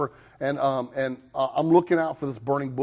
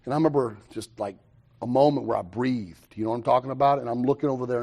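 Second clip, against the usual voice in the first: usual speaking voice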